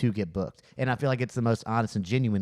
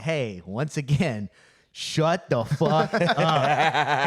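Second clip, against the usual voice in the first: retold second put-down; 3 semitones higher, 4 dB louder, much slower than usual. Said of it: "But I was just like".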